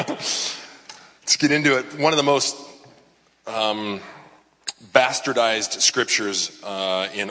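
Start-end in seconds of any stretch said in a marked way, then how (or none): 0.1-2.0 slow, laughing through the words; still laughing. Real, not acted. Pastor recovers from a laugh before the sermon, laughing. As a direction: shake off the giggles and start.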